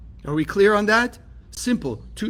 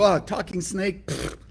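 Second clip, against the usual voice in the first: scoffing skeptic; 4 dB quieter than usual. Retold or imitated. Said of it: "that's why people read the Bible".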